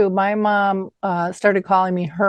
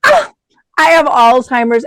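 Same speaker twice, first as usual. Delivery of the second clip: mom's cheeky reply; 5 semitones higher, 7 dB louder than usual. Retold or imitated. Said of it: "my mom would go".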